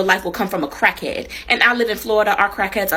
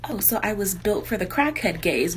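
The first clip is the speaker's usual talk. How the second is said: ordinary talk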